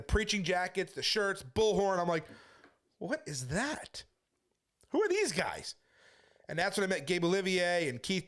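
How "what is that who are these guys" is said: retold astonishment. A preacher recalls first seeing the street preachers, in amazed surprise.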